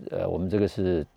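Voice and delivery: usual speaking voice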